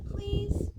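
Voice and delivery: put-on a high, loud childlike plea; pleading. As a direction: beg like an impatient kid. Boy pleads to see treasure.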